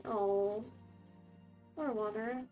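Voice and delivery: ordinary talk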